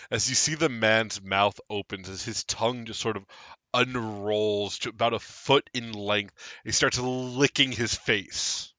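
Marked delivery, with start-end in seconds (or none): none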